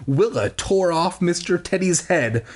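ordinary delivery

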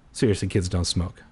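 ordinary delivery, nothing marked

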